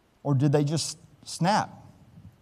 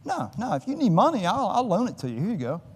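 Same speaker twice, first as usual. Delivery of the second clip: kindly shopkeeper; 7 semitones higher than usual. Retold or imitated. Shopkeeper offering a loan.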